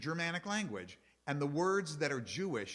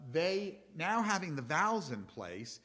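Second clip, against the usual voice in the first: usual speaking voice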